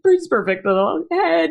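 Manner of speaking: a mother crying as she remembers seeing her stillborn son. Real, not acted. Crying while describing her son.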